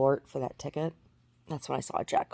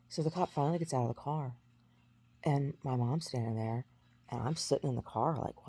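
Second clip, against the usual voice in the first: ordinary delivery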